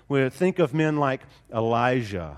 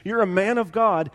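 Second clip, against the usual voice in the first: urging Timothy; 6 semitones higher than usual. Acted. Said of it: "So he's telling Timothy".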